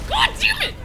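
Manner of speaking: frustrated shout. Real, not acted. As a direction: blow up at the game in pure frustration.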